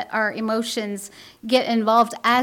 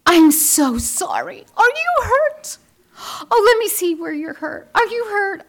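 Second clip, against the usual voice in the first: higher, louder, rushed mock panic; frantic fussing mother; 9 semitones higher, 4 dB louder than usual. Mother fussing over her fallen boy, hammed-up panic. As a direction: fuss over the child as if he broke something.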